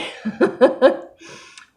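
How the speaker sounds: high unvoiced laugh; breathy laugh. Real, not acted. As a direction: laugh at yourself, embarrassed. Laughing after a yawn.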